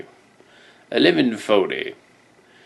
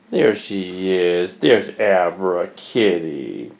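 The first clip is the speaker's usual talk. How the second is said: low, slow cooing at a cat; slow, deep pet-doting voice; low pet talk; 7 semitones lower, much slower than usual. Real, not acted. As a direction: drop deep and draw it out for the kitty.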